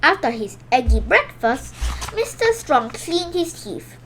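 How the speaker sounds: ordinary delivery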